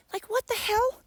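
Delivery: very high, incredulous squeal; baffled disbelief. Acted. Speaker marvelling at giraffes, high-pitched disbelief. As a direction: shoot the voice way up in comic bafflement.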